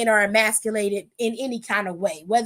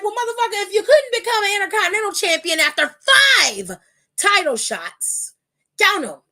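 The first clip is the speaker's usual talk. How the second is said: incredulous scoff; 7 semitones higher, 4 dB louder than usual. Real, not acted. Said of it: "addressing Gable mockingly".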